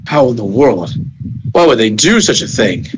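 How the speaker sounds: a deep-voiced stranger, stunned; puzzled low voice. Onlooker staring at the wreckage, deep baffled voice. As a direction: drop low and wonder aloud, baffled. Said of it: "and he's like".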